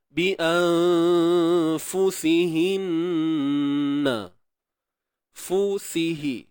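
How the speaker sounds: anfusihinna drawn out slowly with a held nasal on nna; tajwid recitation. Shown as a demonstration. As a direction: stretch anfusihinna, hold the ghunnah on nna. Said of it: "huruf sin baris bawah si". (anfusihinna dibaca perlahan dengan dengung pada nna)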